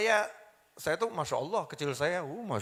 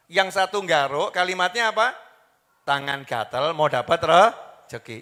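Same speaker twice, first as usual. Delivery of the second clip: usual speaking voice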